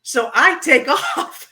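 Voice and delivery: ordinary delivery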